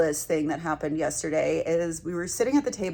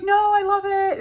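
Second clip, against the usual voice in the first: high gushing reassurance; 13 semitones higher, 7 dB louder than usual. Retold or imitated. Teresa reassuring her.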